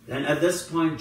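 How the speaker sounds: normal talking voice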